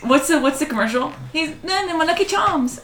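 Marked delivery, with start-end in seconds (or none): 1.6-2.8 high, soft cartoon Irish accent that slips into British; badly faked Irish accent from a cereal advert; botched Irish accent, British-sounding. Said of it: "That was so British".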